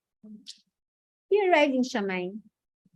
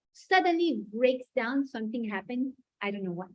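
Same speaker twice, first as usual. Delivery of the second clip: ordinary talk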